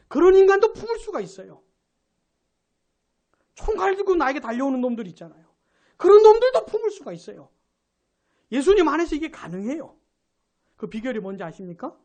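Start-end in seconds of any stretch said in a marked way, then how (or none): 0.1-7.5 fervent preaching. Real, not acted. Preaching climax on loving enemies.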